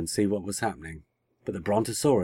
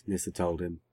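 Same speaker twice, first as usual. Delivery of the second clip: usual speaking voice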